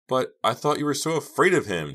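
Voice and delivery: high, loud bewildered protest; crying out. A narrator's reading. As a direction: cry out in disbelief. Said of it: "Jade cried".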